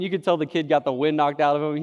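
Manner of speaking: usual speaking voice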